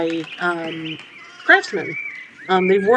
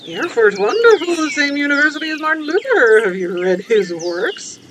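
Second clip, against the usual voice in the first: higher, delighted admirer's voice; enthusiastic game character; 8 semitones higher, 4 dB louder than usual. Acted. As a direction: gush eagerly, then ease into the question.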